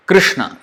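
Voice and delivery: "Krishna" with a single tapped R sound, no vowel after the K; correct Sanskrit Krishna. Shown as a demonstration. Teaching students the correct Sanskrit Krishna, clear demo. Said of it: "the actual pronunciation of the word Krishna".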